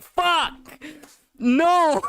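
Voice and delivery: high-pitched anguished cry; mock despair. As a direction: cry out in hammed-up dismay. Mock despair at having to switch.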